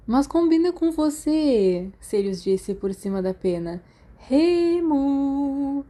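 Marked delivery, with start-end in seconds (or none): 0.1-1.9 slow, higher, sing-song tease; drawing it out to needle his friend; teasing. Acted. Sirius teases Remus about the nickname, slow mock sing-song. 4.2-5.8 slow, higher, sing-song tease; drawing it out to needle his friend; teasing. Acted. Sirius teases Remus about the nickname, slow mock sing-song.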